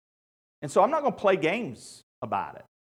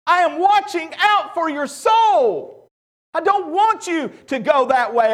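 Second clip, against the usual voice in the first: higher, louder, passionate preaching; preaching at full force; 6 semitones higher, 10 dB louder than usual. Acted. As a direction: pour your heart out to the congregation.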